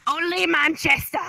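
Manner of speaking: exaggerated Mancunian imitation; mock Manchester accent. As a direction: parrot it in a teasing Manchester accent. Mimicking the other speaker's Manchester phrase.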